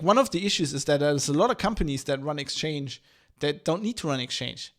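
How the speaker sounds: usual speaking voice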